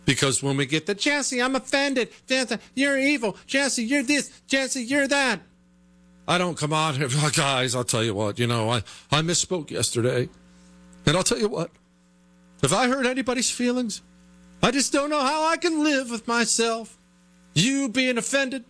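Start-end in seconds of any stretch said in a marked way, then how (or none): 1.0-5.4 high, sneering mimicry of offended listeners; mocking whiny callers. Host mocking his hate-mail writers in a whiny high voice. 7.1-18.6 high, simpering fake-sorry voice; mock groveling apology. Host mocking the apology he refuses to give, simpering and high. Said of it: "I'd say things like".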